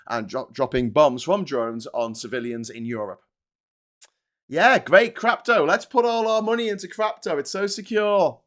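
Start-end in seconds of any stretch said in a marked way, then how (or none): 4.5-8.4 high, loud mock enthusiasm; sarcastic. Mocking crypto fans with loud fake cheer. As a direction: gush over it to mock it.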